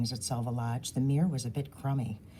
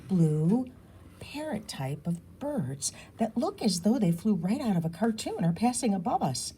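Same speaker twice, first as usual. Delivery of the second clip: usual speaking voice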